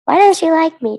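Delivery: put-on a child's pouty complaint; pouting child. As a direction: sulk like a hurt little kid. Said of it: "Taylor pouted".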